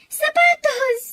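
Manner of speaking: high, loud gift reveal; shouting out the surprise gift; excited shout. Acted. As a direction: announce the present with glee.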